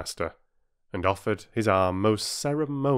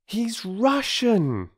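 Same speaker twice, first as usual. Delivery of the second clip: put-on high, slow boyish exclamation; excited cry; 4 dB louder, much slower than usual. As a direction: Peter bursts out with the discovery. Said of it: "cried Peter".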